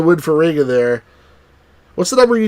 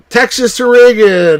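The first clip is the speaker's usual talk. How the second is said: excited announcement; 7 semitones higher, 5 dB louder, slower than usual. Real, not acted. Announcing big state result.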